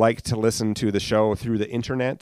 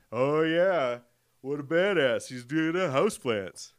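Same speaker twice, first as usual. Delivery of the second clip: mocking listener; 3 semitones higher than usual. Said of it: "I can hear you just all roll your eyes, talk shit".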